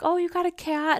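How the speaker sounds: light up at the sight of the cat; delighted squeal. Retold or imitated. To grandpa in the dream, a high delighted squeal.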